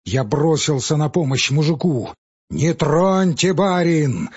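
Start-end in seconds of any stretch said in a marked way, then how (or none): 2.5-4.3 loud, slow, higher command; shouted warning. Acted. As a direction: bark at the master to stay back. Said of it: "крикнул на меня лесник".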